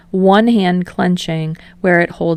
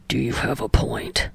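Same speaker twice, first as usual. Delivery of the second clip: tiredly; 6 semitones lower, 7 dB quieter than usual. A narrator's reading. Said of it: "Bakugo says tiredly".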